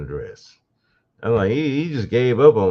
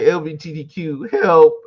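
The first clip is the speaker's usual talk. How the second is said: high mock cry for help; mocking Tyrese, calling out; mocking plea; 6 semitones higher, 5 dB louder than usual. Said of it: "now he's like".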